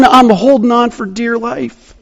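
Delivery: ordinary talk, nothing marked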